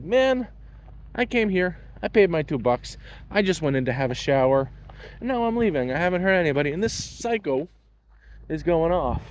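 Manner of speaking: high, pleading protest; exasperated pleading. Retold or imitated. Telling the lifeguard he only came to shower, exasperated.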